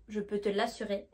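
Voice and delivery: normal talking voice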